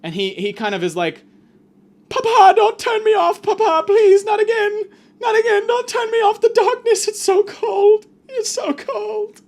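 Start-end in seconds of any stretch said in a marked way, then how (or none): 2.1-9.4 put-on very high, loud crying toy with London accent; hammed-up London-accented wailing, high and loud; pleading London accent. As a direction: beg tearfully as a squeaky London-accented digital child. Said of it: "I don't know why he has a London accent".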